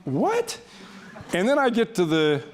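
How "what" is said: sharp, much higher disbelieving what; shocked what. Retold or imitated. Son reading mother's letter, stunned high what. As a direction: yelp of disbelief at the words. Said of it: "I'm going on".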